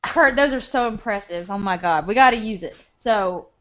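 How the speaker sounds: ordinary delivery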